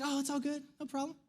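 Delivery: soft, offhand reassurance; downplaying it. Wife calming her spiraling husband, softly downplaying it. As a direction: imitate a nurse brushing it off quietly. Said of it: "she downplays it".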